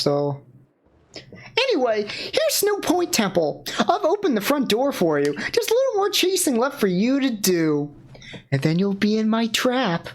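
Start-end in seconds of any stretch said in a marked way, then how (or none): 1.6-10.1 high, teasing villain voice; high sly villain voice. Acted. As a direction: lure them into the trap, sly and high. S1 voices villainous game character.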